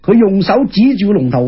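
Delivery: plain reading voice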